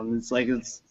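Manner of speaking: ordinary talk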